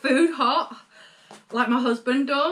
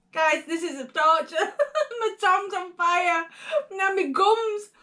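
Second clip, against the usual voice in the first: laughing; 9 semitones higher than usual. Real, not acted. Laughing through the chili burn.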